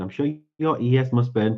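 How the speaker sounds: ordinary delivery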